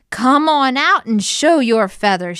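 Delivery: loud, high angry challenge; yelling. A narrator's reading. Henrietta challenges the hidden egg napper, angry yell. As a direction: holler it as a fuming hen. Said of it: "she yelled".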